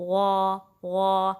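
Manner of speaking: غا loud and long, a heavy smooth gh; smooth heavy ghain. Shown as a demonstration. Teacher demonstrating ghain, smooth heavy غا. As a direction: hold a full back-of-tongue غا without scraping. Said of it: "smoothly اور heavy وے میں".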